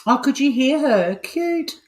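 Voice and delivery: high coo to the snoring cat. Real, not acted. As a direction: coo over the sleeping cat.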